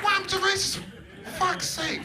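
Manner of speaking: high, exasperated cry of disbelief; mock fury that racism is gone; mock outrage. Outrage at getting the job.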